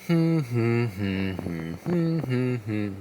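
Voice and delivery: steady breathy hum on the out-breath; humming. Shown as a demonstration. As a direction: hum through the nose for the bee breath. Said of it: "making a noise like this".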